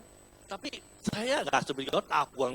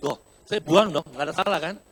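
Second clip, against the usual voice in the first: louder, mock-defensive protest; indignant exclamation; 11 dB louder than usual. Pastor retelling his rubbish mishap to the church, loud mock indignation. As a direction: protest your innocence loudly.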